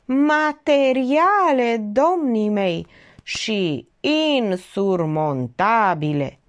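very slow, stretched-out "Materiale"; syllable by syllable. A narrator's reading. Uncle drives home his proofs to the gentlemen, syllable by syllable. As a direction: spell out "Materiale" and "insurmontabile" in drawn-out syllables.

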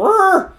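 loud high honking squawk; goose honk imitation. Imitating a fluttering prop as a goose honk. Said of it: "all of a sudden it'll go".

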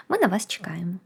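soft unvoiced whisper. Real, not acted. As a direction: breathe it like a secret. Closing line of an ad appeal.

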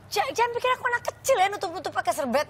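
high, indignant mock scolding; imitating angry son. Mother imitates her son telling her off. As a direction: play the offended son, pitch way up. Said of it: "dia bilang gini".

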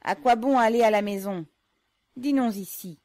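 loud, falling call of a name. Acted. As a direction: call his brother out loud. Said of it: "dit-il à haute voix".

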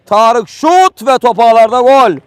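goal call, shouted high and loud; screaming football commentator. Real, not acted. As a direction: belt out the goal. Penalty scored, commentator erupts.

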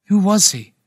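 loud, high, urgent question. A narrator's reading. Narrator pressing the old man about the soldier, urgent. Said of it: "my heart racing".

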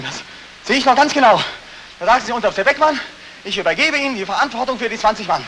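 loud and rising, pressing every detail; agitated loud accusation. Acted. Veteran loudly confronting his colonel, agitated shout. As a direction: hammer the memory at him.

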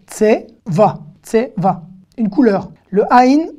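ordinary delivery

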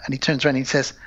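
ordinary delivery

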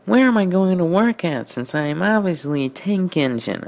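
high put-on voice for Twilight; character voice. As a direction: read it as the bewildered pony-engine. Reading Twilight's dialogue in character.

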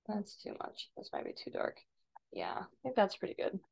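very soft, near-whispered self-talk; muttering to self. Real, not acted. Tutor judging an adjustment to themselves, muttered very softly. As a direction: think aloud under your breath.

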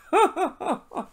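high run of giggles; rapid, high ha-ha-ha; real laugh. Real, not acted. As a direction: laugh after landing the punchline. Laughing after the punchline.

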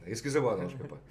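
ordinary talk

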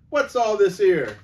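high, loud official bark; policeman. Acted. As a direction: demand order from the crowd. Policeman demands an answer.

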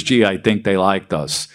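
lightly voiced Brooklyn accent. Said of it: "So originally it was".

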